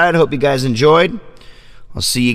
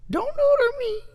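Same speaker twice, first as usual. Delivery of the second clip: very high, soft cat voice pleading; voicing the cat; 7 dB quieter than usual. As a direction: beg like a little cat, way up high. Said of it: "she says".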